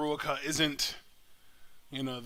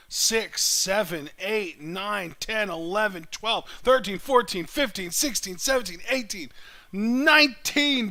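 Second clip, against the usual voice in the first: counting chops, building louder and higher; rising hype with every chop; excited counting; 6 semitones higher, 7 dB louder than usual. Real, not acted. Counting chops as they land.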